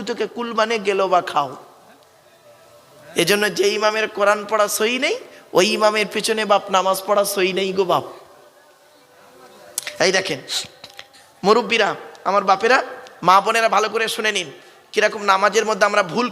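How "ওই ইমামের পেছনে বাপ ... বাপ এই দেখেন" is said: ordinary delivery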